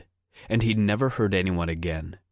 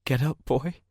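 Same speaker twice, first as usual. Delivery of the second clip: high, desperate coaxing of a dog; pleading; 7 semitones higher than usual. Acted. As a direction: plead with him to get up. Begging dead dog to rise.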